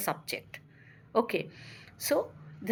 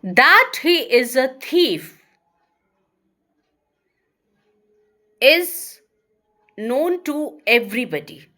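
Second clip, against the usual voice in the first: slow and louder, long pause before the verb; louder slow dictation; 7 dB louder than usual. Shown as a demonstration. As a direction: dictate the sentence in chunks. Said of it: "another example I'm going to give you".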